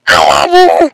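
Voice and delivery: very low booming love you; deep voice. Pretend. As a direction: drop to a monster bass. Deep voice repeat.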